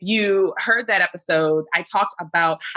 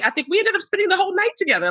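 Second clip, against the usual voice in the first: excited; 12 semitones higher, much faster than usual. Real, not acted. Excited recall, high and fast.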